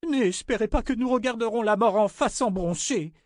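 high, sustained defiance; defiant outburst. A narrator's reading. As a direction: hurl defiance at her, pitched high. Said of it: "lança Ornetta". (défi lancé d'une voix aiguë)

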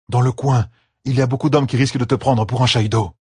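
a touch of urgent warning to a friend; lightly voiced Perrine, cautioning. Perrine warns Gaul about the men nearby, lightly urgent.

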